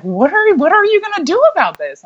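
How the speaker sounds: very high, incredulous retold question; disbelieving professor. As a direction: imitate a professor who can't believe it. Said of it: "she couldn't believe it".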